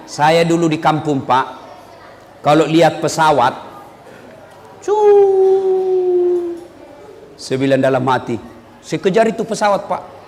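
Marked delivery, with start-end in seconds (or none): 4.8-6.6 long high "cuu" like a jet passing; high airplane whoosh. Retold or imitated. Child in the village hearing a plane, high whoosh. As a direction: mimic the plane flying overhead.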